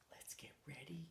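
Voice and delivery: soft, breathy whisper. Real, not acted. Reader hushing children before Old Man's yell, whispered.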